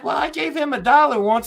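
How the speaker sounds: very high falsetto Southern mother; cartoon mom. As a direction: imitate a cartoon wife piping up in falsetto. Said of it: "And she like".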